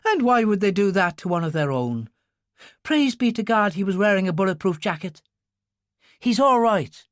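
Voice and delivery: high-pitched clerical character voice; agitated priest. Acted. Father Tim reacts to the attempt on the senator, agitated.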